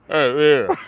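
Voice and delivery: loud, muffled cartoon greeting; booming mush-mouthed impression; Family Guy character with gun in mouth. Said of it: "Family Guy guy with the gun in his mouth".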